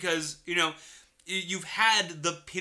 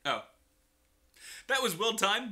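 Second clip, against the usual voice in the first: usual speaking voice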